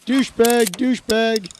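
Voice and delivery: mock shout. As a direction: call it out like a mock warning. Mimics the officer's shout in clip.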